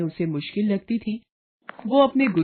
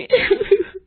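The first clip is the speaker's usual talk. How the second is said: a high, breaking sob; 14 semitones higher than usual. Acted. Mother-in-law at her husband's feet, sobbing high. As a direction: let out a wailing sob. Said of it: "रोती हुई".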